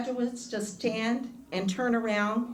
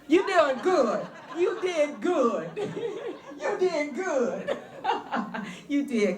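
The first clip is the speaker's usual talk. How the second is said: laughing through the praise, higher and slower; 3 semitones higher than usual. Real, not acted. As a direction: giggle as you tell them they did well. Praising graduates, laughing between.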